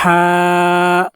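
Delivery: "ہا" pushed hard from the throat. Shown as a demonstration. Teacher demonstrating the throat sound: "ہا" forced. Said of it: "گلے سے زور لگا کر".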